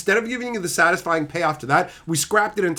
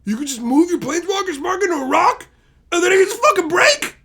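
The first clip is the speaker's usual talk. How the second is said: much higher and louder, cracking with disbelief; outraged yell; 12 semitones higher, 6 dB louder than usual. Real, not acted. Ranting to chat about the spark in a rock, outraged yelling. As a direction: shout it like it's absurd.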